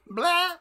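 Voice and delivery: very high, loud squeaky blah; bat death squeak. Pretend. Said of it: "That's a bat dying".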